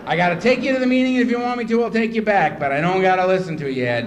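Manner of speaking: loud, heated retort; acted irritated rant at full volume; angry telling-off. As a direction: lay down terms in mock fury.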